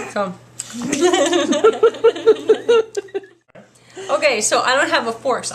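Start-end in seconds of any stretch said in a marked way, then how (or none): 0.6-3.3 loud, high burst of laughter; big laugh. Real, not acted. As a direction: laugh long and loud at yourself. Laughs after her pig noise.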